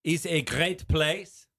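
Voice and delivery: put-on Monet in a heavy French accent; thick French accent. A French painter being interviewed, in a thick French accent. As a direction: speak English through a thick French accent. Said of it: "I am speaking in a very thick French accent".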